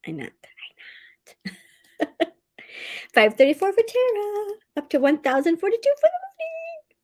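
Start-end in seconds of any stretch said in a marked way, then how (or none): 3.1-7.0 high, excited announcing of the numbers; excited high pitch. Real, not acted. Host reading writers' word counts, thrilled and high-pitched. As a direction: let the numbers squeal out with delight. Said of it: "I get excited, I get high pitched".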